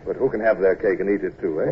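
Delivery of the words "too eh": usual speaking voice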